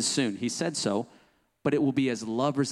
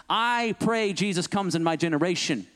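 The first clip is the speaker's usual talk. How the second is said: ordinary talk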